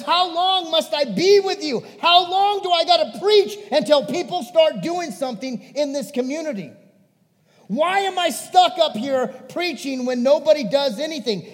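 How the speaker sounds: loud, high, fed-up outburst; impassioned, exasperated rebuke; Jesus at full intensity. Acted. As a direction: demand answers at the end of your patience. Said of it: "he would have that intensity".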